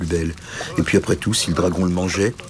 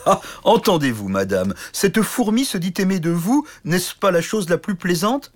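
mocking laughter; 10 semitones higher than usual. Knight mocking the little suitor to the princess, high and gloating. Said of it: "éclata de rire".

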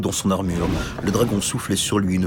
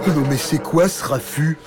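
higher, odd grumbling dragon voice; cranky just-woken dragon; grumpy dragon; 6 semitones higher than usual. Acted. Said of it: "cria le dragon".

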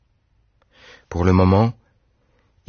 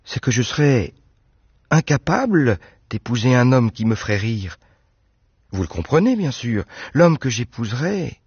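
put-on higher, eager young woman's voice; Anne protesting; 5 semitones higher than usual. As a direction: insist he has it all wrong. Anne explains.

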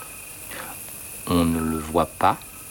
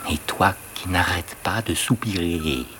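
usual speaking voice

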